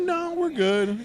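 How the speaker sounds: high, breezy no-thanks; mock-casual refusal of an autograph, high; polite brush-off. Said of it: "We're like".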